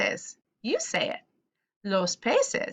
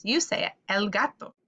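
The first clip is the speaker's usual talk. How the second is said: ordinary delivery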